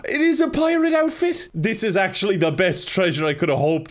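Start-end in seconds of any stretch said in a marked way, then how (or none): 0.0-1.5 high, loud joyful cry; excited shriek. Real, not acted. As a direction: squeal with delight. Found the pirate outfit.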